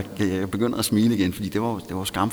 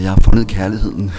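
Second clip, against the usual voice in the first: loud, overjoyed proclamation; ecstatic declaration; 13 dB louder than usual. Retold or imitated. Kneeling before the counselor, declaring love with a loud, joyful proclamation. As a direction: announce it like a revelation.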